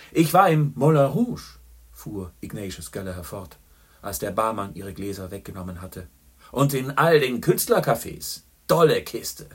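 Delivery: loud, high, jovial barroom greeting; a boisterous journalist ordering whisky for his friend; hearty old pal. A narrator's reading. Boastful Gallagher greets Chandler, loud and jovial. Gallagher brags about Moulin Rouge.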